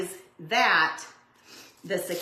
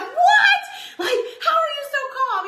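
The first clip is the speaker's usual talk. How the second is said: startled; 7 dB louder than usual. Retold or imitated. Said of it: "I'm like".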